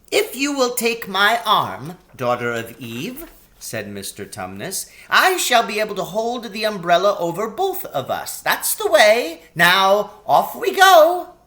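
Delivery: a faun leading off with gusto; high jolly faun, building up; cheerful faun. Acted. Faun character voice.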